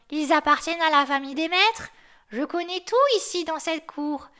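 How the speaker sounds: the yard dog boasting he knows everything; grumpy old guard dog. Acted. The chained dog tells the snowman about his masters, in a high, loud put-on voice.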